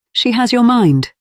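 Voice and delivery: a hint of a young woman; Rosalind's voice. A narrator's reading.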